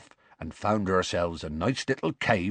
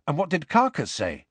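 eager; 7 semitones higher than usual. A narrator's reading. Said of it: "asked Harry eagerly".

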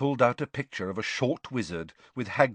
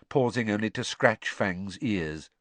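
ordinary delivery, nothing marked

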